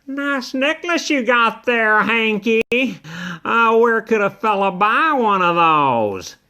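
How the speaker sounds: high, smug purr of a teasing cat; sly cat taunt. Acted. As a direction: needle him, all fake sweetness. Pete the cat taunts Hank.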